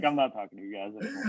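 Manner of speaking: quick, muttered mock sulk; mock sulky snub. Mimicking his friend's sulky snub of the rival fans. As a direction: brush them off in a quick sulky mumble.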